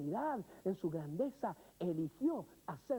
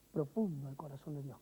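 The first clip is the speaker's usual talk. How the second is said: sorrowful drop; 7 semitones lower than usual. Acted. Gozo vs dolor contrast.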